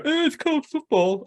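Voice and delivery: very high, prissy mock correction; mock pedant. Mocking people who correct soccer, high mock voice. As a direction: mimic a fussy know-it-all. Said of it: "I'm not gonna go".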